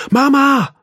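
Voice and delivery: loud high yell for mom; bellowing. A narrator's reading. Arthur brüllt nach seiner Mutter, sehr laut. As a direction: shout down the house. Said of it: "brüllte Arthur".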